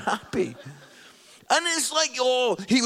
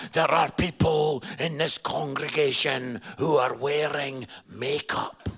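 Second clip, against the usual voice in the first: much lower accusing preacher voice; single out the sinners, grave and stern; scary preacher; 7 semitones lower than usual. Retold or imitated. Imitating the preacher condemning makeup wearers, low and accusing. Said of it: "he was like".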